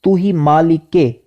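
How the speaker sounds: مالک کے with a clear zer, said as "malik-e"; correct pronunciation. Shown as a demonstration. Teacher showing students the zer on مالک, careful pronunciation. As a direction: stress the kasra: "تو ہی مالک کے". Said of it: "کاف کے نیچے زیر آئے گی".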